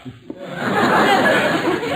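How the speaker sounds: high unvoiced snicker; smug breathy chuckle at his expense; mocking laugh. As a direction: gloat with an airy laugh. Bullard mocking Gildersleeve.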